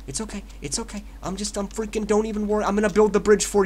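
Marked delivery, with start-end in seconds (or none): 0.1-1.0 hushed, soothing reassurance; quiet mock comforting; soft coaxing. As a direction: coax them softly. Coaxing an opponent in game.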